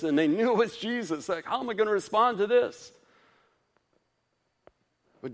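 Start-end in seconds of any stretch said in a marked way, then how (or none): 1.4-2.9 high, louder fretful question; mock panic. Flustered disciple wondering how to respond, high and panicky.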